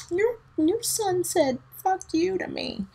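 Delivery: put-on high slow tattling child voice; tattling kid. Student reporting her son to the teacher, high. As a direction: tell on him like a wronged kid. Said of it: "he's like".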